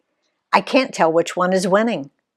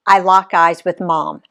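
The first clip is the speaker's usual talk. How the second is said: usual speaking voice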